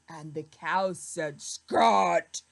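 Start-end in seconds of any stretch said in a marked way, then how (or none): 1.4-2.4 louder "Scat". Acted. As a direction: bellow it at the pig. Cow shoos the pig.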